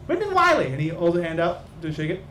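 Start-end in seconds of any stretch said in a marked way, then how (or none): 0.1-0.7 very high, nervous name; timid high voice. Acted. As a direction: squeak out his full name. Whitnan repeats his name.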